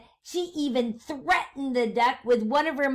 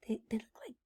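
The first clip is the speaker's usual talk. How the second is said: whisper; 13 dB quieter, much faster than usual. Real, not acted. Teacher hints secretly to class.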